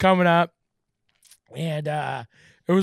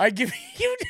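laughing mock speech. Mock grand gift speech.

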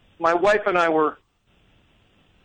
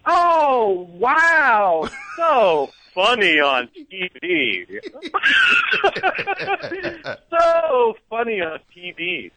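starstruck gushing; 9 semitones higher, much slower than usual. Retold or imitated. Caught off guard by the celebrity chef, gushing awkwardly. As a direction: stretch each word out in awkward, over-the-top awe.

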